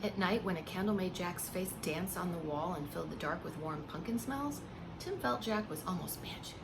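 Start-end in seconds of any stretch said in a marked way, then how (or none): none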